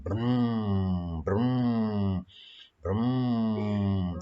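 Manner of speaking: car engine rumble; engine vroom. Shown as a demonstration. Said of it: "tirukan suara mobil berjalan".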